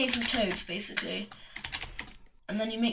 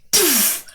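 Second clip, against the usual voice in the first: higher puffed-out burst; imitated poof; 4 semitones higher, 3 dB louder than usual. Narrator mimics another Goomba hit, higher airy burst. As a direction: blow out a sudden "poof". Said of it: "And then he goes".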